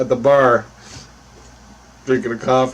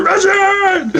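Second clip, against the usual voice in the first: high, stretched mimicked yell; imitated high-pitched summons from the boss; boss calling out; 4 dB louder than usual. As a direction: holler the name across the shop. Boss yells his name again.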